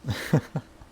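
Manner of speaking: high breathy laugh of relief; a bright airy burst of laughter; happy laugh. Acted. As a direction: laugh out the giddy joy. Happy after learning of feelings.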